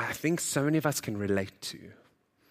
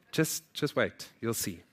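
ordinary talk, nothing marked

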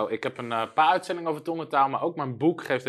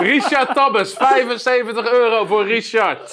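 auctioneer; 10 semitones higher, 9 dB louder, slower than usual. Acted. Calling out a bid like an auctioneer.

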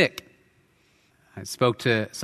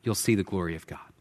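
softer, lower reassuring reply to Martha; calm her fears tenderly; gentle Jesus; 4 semitones lower, 5 dB quieter than usual. Retold or imitated. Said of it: "Jesus looks back at her and says".

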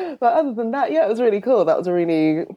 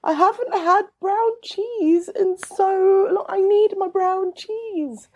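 high, drawn-out mock lament; playful pining; 14 semitones higher, slower than usual. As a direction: plead for it in a slow, high wail. Playfully pining for brown cheese.

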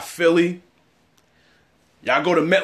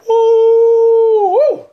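high, loud woo; shrill woo of disbelief; mock whoop; 9 dB louder than usual. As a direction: whoop like it's a disaster. Mock alarm if they lose.

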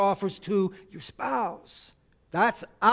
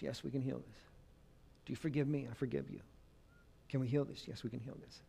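tender apology; 4 semitones lower, 6 dB quieter than usual. Acted. Acted reconciliation dialogue, soft.

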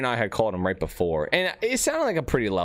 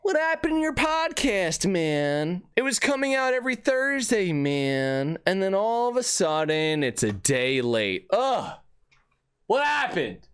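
mock complaining fan; 11 semitones higher, slower than usual. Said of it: "you might be thinking".

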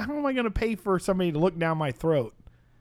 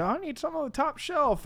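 put-on high, softer old-lady voice; 12 semitones higher, 5 dB quieter than usual. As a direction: imitate an old lady's request, pitched way up. Old lady answers the helper.

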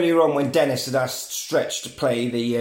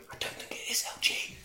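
hushed, voiceless whisper; whispered reply; 13 dB quieter, faster than usual. Retold or imitated. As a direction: whisper it as an eerie answer. Replying voice in scary studio scene.

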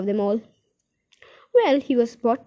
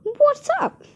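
calling down; 3 dB louder than usual. Acted.

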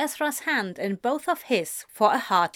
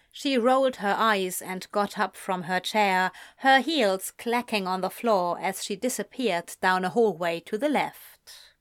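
ordinary delivery, nothing marked

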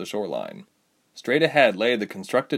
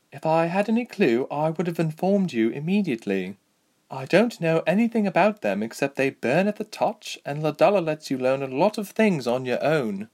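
higher-pitched, frank woman fighter; Lieselot; 7 semitones higher than usual. Acted. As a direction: answer lightly in a raised character voice. Voices Lieselot's reply.

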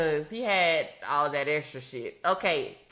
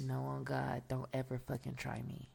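low, quiet menacing threat; evil voice; 7 semitones lower, 12 dB quieter than usual. Acted. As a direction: say it dark and quietly. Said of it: "Ray with the evil voice".

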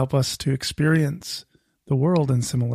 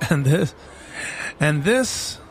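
mock tour guide; slower than usual. Mock tour guide gesturing grandly.

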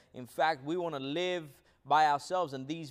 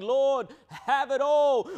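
louder and higher, slowly pleading; surrendering cry; 5 semitones higher, 6 dB louder, slower than usual. Acted. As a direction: give it all up, crying out to the Lord. Preacher voices surrender prayer.

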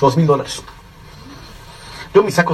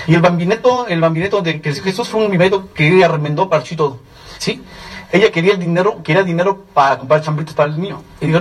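normal talking voice

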